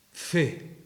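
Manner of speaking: "fe" set apart after a pause, with a careful F; deliberate F. Shown as a demonstration. Speaker theatrically presents the word to the audience, deliberate F on "fe". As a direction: pronounce "fe" as a word needing a wet-mouthed F. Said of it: "La F requiere un poco de salivilla".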